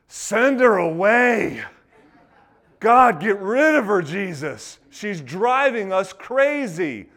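loud, high, drawn-out complaint; exasperated shout. Acted. Disciples begging Jesus to be rid of her, exasperated shouting. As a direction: holler in fed-up desperation.

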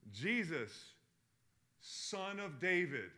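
imitating a blind man's cry; retold scream. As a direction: call out slowly, a held-back scream. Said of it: "He screams".

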